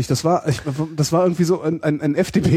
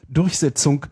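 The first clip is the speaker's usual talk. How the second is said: usual speaking voice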